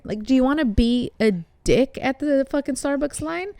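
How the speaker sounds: ordinary delivery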